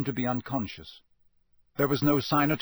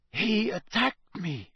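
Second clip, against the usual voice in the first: put-on slow, higher character voice with an accent; muttering Krum; 8 semitones higher, slower than usual. A narrator's reading. Krum dazed, telling Dumbledore of the attack, accented mutter. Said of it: "Krum muttered".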